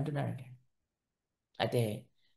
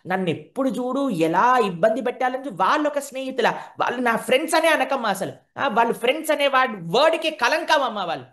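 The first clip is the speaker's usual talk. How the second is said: put-on protesting child; 7 semitones higher, 3 dB louder than usual. Child Krishna protests to mother.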